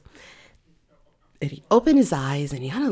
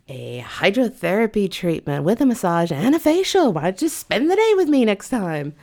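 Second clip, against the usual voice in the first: high, lilting coy back-and-forth; hammed-up flirting, pitched up; flirty banter; 4 semitones higher, 4 dB louder than usual. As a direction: play up coquettish sales talk. Acted flirty booking exchange.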